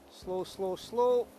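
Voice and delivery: normal talking voice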